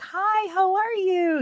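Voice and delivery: put-on very high, sing-song hello to a child; sweet high greeting. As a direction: greet a little kid brightly.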